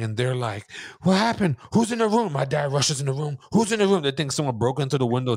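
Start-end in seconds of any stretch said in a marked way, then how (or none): 1.0-2.3 raised, higher worried question; family demanding what happened; alarmed family. Retold or imitated. Family in the hallway asking the scared boy, loud and high. 3.5-4.0 raised, higher demand; dad storming in asking who's there; alarmed dad. Retold or imitated. Dad rushing into the room, asking loudly and high.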